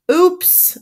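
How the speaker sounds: high, rising little exclamation; startled oops. A narrator's reading. Iron Man sees laundry dragged away, startled oops. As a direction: pop it up high in dismay.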